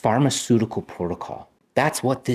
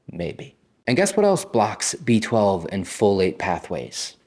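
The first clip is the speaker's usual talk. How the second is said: usual speaking voice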